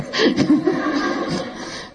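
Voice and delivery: high unvoiced laugh. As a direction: let out a scornful laugh. Laugh after taunt.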